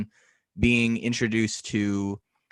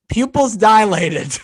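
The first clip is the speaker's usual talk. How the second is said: mock surprise; 8 semitones higher, 9 dB louder, slower than usual. Shown as a demonstration.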